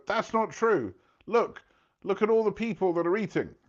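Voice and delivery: high, defensive mock protest; indignant owners. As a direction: owners insist Gordon is wrong. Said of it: "And they go".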